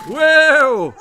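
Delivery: very high, very loud shout. Acted. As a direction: yell it to rein the horse. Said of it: "Lady Davinia shouted".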